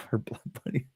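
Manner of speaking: breathy half-laughed whisper; whispered aside. Real, not acted. Streamer whispering a private joke to chat, quietly. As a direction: murmur it under your breath with a laugh.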